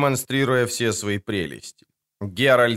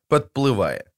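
ordinary delivery

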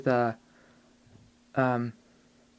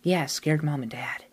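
whispering; 5 dB quieter than usual. Acted. Ghost girl whispers a secret.

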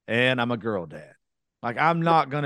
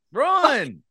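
mock yell; 6 dB louder than usual. Shouted warning joke.

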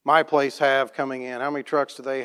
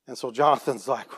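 ordinary delivery

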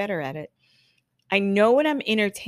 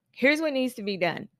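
higher, decisive statement; confident; 6 semitones higher than usual. Shown as a demonstration. Stylist telling a regulator the plan, confident. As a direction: announce it with assurance. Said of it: "with confidence".

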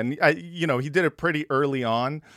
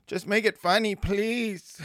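high-pitched, comically desperate plea; pleading; 9 semitones higher than usual. Acted. S1 to makers of bad message art, pleading. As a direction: beg in a high voice, half laughing.

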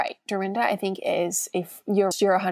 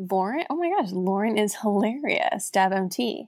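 usual speaking voice

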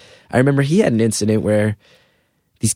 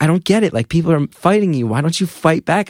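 higher-pitched, exasperated retelling of dad; frustrated dad; 6 semitones higher than usual. Retold or imitated. Dad questioning his bullied son, exasperated. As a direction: push for an answer, frustrated. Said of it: "he would get frustrated sometimes".

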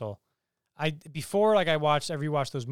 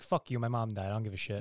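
low, softer mock indifference; dismissive retelling; 6 semitones lower, 6 dB quieter than usual. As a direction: toss it off like you don't care. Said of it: "he was kinda just like".

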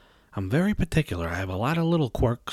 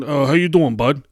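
a lightly voiced chummy driver, louder; mock driver greeting; 2 semitones higher, 8 dB louder than usual. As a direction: ham up a dopey driver's hello. Mocking the Uber driver.

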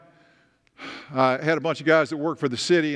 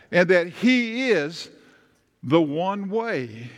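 slow, weighty preaching, each phrase set apart; 3 semitones higher, slower than usual. Acted. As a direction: lean into the message, slowly and deliberately. Slow emphatic preaching climax.

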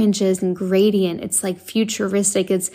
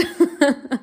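light high laugh at her own joke; short laugh; 6 semitones higher than usual. Real, not acted. Host laughing at her own teasing retort, short laugh.

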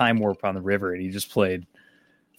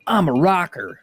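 higher-pitched mock rocker declaration; movie rocker impression; 8 semitones higher than usual. Host quotes a Joe Dirt scene to friends, imitated rocker voice. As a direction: imitate a self-proclaimed rocker. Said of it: "where he's like".

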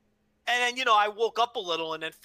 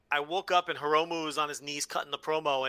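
usual speaking voice

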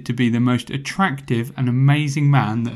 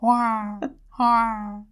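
eagle impression; 8 semitones higher, 4 dB quieter than usual. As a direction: screech like a soaring eagle. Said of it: "That was my impression of an eagle".

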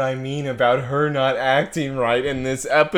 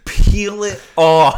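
hammed-up, slow, pitched-up plea; creepy eager offer; 10 semitones higher, 10 dB louder, much slower than usual. Offering own skin to Simone, hammed-up and creepy. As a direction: drag it out, high and loud.

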